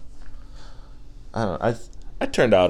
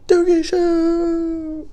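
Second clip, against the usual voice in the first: high, slow, drawn-out gleeful shout; joyful cry; much slower than usual. Acted. Cheering while sliding out on the mixtape cover. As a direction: stretch it out like sliding.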